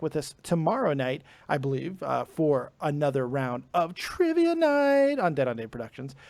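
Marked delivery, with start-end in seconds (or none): 3.9-5.2 high, held, drawn-out announcement; showy announcer flourish. Pretend. Host plugging tomorrow night's show, showy announcer flourish. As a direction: stretch the show title like an announcer.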